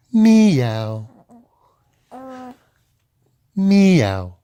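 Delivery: drawn-out meows with a falling slide; playful cat calls, spaced apart; kitten meows. Acted. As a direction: mew like a kitten. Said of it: "kittens go".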